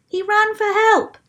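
high, loud guess from the crowd; eager shout. A narrator's reading. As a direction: call out the answer eagerly. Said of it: "shouted someone".

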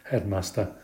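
ordinary delivery, nothing marked

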